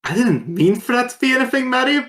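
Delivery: high laughing giggle. Real, not acted. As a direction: laugh through the words.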